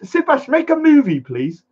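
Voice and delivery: high-pitched mock plea; playful urging. Real, not acted. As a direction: urge him on in a high teasing voice. Jokingly urges cameraman to make film.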